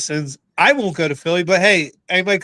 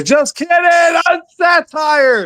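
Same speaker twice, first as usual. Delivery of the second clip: mock shout; 10 semitones higher, much slower than usual. Real, not acted. Joking jab at soccer fans.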